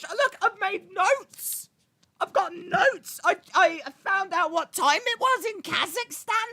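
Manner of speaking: high, loud panicked protest; hammed-up panic. Streamer protesting they will be attacked over this, hammed-up panic. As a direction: plead your innocence in a squealing mock panic. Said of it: "now I'm panicking".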